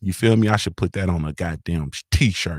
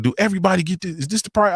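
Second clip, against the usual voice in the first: mock questioning customer; 10 semitones higher, faster than usual. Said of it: "He like".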